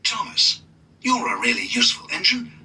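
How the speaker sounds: mild encouraging conductor voice. A narrator's reading. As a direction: urge Thomas he can do it. Mister Conductor encourages Thomas.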